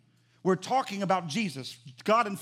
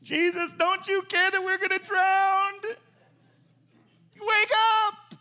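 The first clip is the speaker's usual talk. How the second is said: much higher, full-force yell; demonstrated panicked shouting; 11 semitones higher, 7 dB louder, slower than usual. Shown as a demonstration. Disciples waking Jesus in the storm, full-out acted shout. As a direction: scream to wake someone as you're drowning. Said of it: "I gotta demonstrate this a little bit better".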